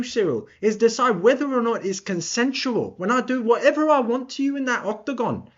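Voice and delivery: put-on terrible high-pitched English accent; mock English fighter's trash talk; Tom Aspinall. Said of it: "Terrible English accent".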